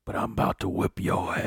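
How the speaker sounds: husky whispered Madea growl; man doing a woman's voice. Retold or imitated. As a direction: threaten in a hushed put-on voice. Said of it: "his girl voice".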